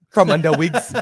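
loud, high, laughing through it; laughing slogan. Real, not acted. Cohost repeating the slogan while laughing, loud and high.

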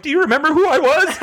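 high, fast, laughing gush; giddy excited question. Retold or imitated. A fan asks John Cook with a photo, high laughing gush. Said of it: "I'm like".

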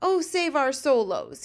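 slow, loud anguished wail; fury and anguish. A narrator's reading. Giant cries out over the nightmare, anguished. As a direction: drag out the cry in despair. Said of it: "His voice was filled with fury and anguish".

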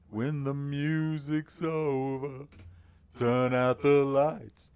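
slow, drawn-out rock singer voice; long held words in a put-on rock star voice. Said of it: "talk about his [name] impression".

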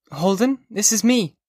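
put-on high, sharp girl's voice; a girl woken by a drunk caller; young woman Sally. A narrator's reading. Sally answers drunk Holden, annoyed.

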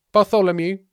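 "Bartholomew" announced high and loud; high-pitched "Bartholomew" self-introduction; forgotten disciple. Acted. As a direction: call out "Bartholomew" from the back. Unknown disciple announces his name.

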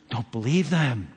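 normal talking voice

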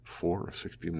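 hushed shocked echo; stunned disbelief. Retold or imitated. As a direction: echo "Four or six people?" in quiet shock. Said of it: "I just couldn't believe it".

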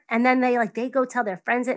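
ordinary talk

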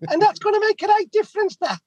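very high squeaky imitation; exaggerated high neighbour voice; high-pitched voice. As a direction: neighbour thanking the plumbers, mock high voice. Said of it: "didn't have quite a high-pitched voice like that".

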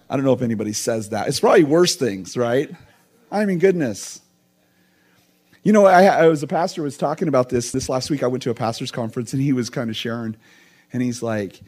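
normal talking voice

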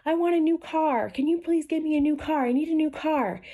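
high, pleading put-on voice; imitating someone praying for a handout, pitched high; mock pleading prayer. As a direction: beg God like a wheedling child. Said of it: "You can't just be praying like".

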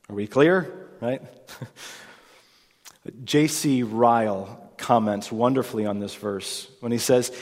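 ordinary delivery

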